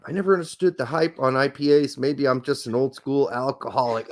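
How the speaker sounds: ordinary talk, nothing marked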